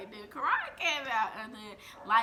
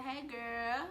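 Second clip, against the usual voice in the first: put-on baby voice greeting.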